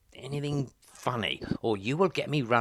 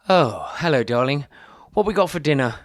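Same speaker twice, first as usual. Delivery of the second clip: loud; 2 semitones higher, 9 dB louder than usual. Acted. Home to his wife.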